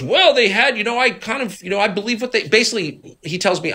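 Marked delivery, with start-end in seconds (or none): 0.0-2.5 high, mocking whine of his feeble excuse; mocking imitation. Mocking the congressman who believed his briefers. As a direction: parrot him back, sounding spineless. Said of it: "He goes".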